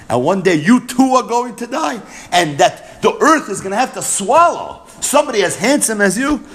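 a mournful rising plea; emotional lament. Retold or imitated. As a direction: cry out the loss, pitch up. Rabbi Lazar's lament voiced emotionally.

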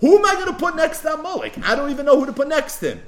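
high, fast, bewildered king wondering aloud; puzzled king. Retold or imitated. King wondering whom to seat beside Amalek, high and quick. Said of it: "Kach Amar Moshna".

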